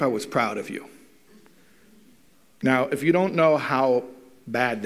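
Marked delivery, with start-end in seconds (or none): 0.0-0.9 flat dismissal. Retold or imitated. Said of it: "the father says to the son".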